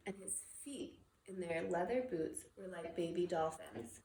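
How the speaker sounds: ordinary delivery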